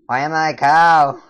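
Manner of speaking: loud, high, incredulous yell; dismayed shout. Pretend. Player stuck as a cow in the game, dismayed shout. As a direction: yell the question in mock despair.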